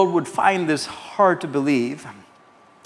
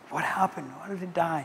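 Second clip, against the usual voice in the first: put-on hushed, mildly shocked child; shocked boy; 2 semitones higher, 8 dB quieter than usual. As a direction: quietly mimic a stunned little boy. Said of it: "the boy was shocked".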